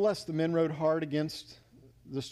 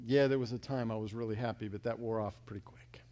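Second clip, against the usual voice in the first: low, mild regret sinking to a hush; lightly voiced private confession; rueful admission; 7 semitones lower, 4 dB quieter than usual. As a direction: admit it quietly, trailing down. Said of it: "you're gonna know that".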